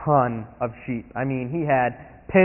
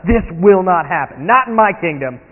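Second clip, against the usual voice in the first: loud, slow furious king; incensed David; 4 semitones higher, 7 dB louder, slower than usual. Acted. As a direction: slam each NOT down in outrage. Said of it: "David is incensed. He is ticked off".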